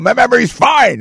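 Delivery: high-pitched imitation of an indignant yell; mock yelling. Host mocking the president's press conference, mock yell. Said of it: "And he starts yelling".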